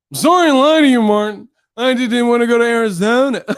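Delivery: loud, high exaggerated kid mimicry; mocking the boy. Martin mocking the boy's apology, loud high mimicry. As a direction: parrot it back whiny, ending in a laugh. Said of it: "he would mock the people's voices".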